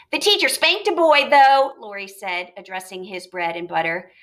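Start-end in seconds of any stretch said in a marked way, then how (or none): 0.1-1.7 put-on eager child. Boy tells news eagerly.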